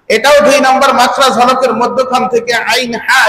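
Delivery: normal talking voice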